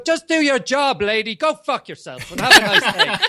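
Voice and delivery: loud, high Boston telling-off; Boston accent. Retold or imitated. Telling off the tram driver, loud Boston accent. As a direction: imitate a fed-up Bostonian having a go. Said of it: "I love the accent".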